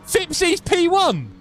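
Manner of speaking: high, slow, ringing call of the leader; thrilled commentator announcing P one; excited race call. Real, not acted. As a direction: belt out the new race leader.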